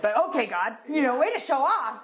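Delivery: mock-impressed ribbing; playful teasing. Ribbing God about dropping money from the sky, cheeky.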